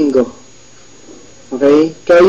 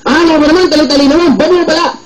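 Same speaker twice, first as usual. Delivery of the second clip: high-pitched scolding as mom, mildly hammed; playful impression of a scolding mom; mother scolding; 7 semitones higher than usual. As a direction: mimic an exasperated mother nagging her son. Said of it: "galit na galit".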